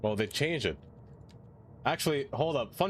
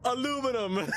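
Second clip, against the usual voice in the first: very high, strained "Aluminum"; squeaky comic reply; 14 semitones higher than usual. Acted. Streamer answers the donation voice, squeaky and high. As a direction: squeak it out mockingly. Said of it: "Now say it the correct way".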